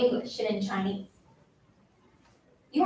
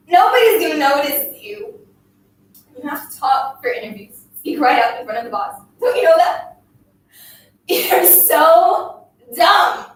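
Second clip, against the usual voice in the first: raised, higher exasperated berating; scolding tirade; 5 semitones higher, 6 dB louder than usual. A narrator's reading. Bully belittles the silent girl, loud exasperated tirade. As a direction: lash out loud, stretching the insult.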